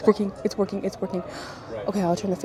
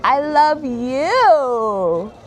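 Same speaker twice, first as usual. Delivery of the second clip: very drawn-out, loud, swooping coo; gushing affection; 3 semitones higher, 6 dB louder, much slower than usual. Real, not acted. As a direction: stretch it out lovingly for a little nephew. Last gushing reply to nephew.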